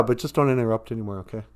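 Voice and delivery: normal talking voice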